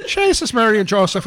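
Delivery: high, hammed-up Irish brogue; playful mock Irish voice; Irish accent. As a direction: camp it up like a stage Irishman. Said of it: "you guys do the best Irish accents".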